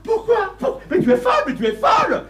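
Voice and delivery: cri outré très aigu; s'étrangler d'indignation, voix qui monte d'un coup; indignation explosive. Acted. Mari découvre la proposition de sa compagne. (hors de lui, scandalisé)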